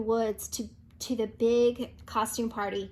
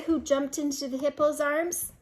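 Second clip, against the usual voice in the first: ordinary delivery, nothing marked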